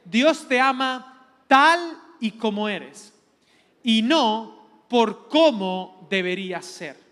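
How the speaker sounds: slow, loud declaration with weighted key words; emphatic mantra. Retold or imitated. Preacher quoting a beloved mantra to the class, slow and emphatic. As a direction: proclaim it slowly and forcefully, phrase by phrase. Quotes his favourite mantra to congregation.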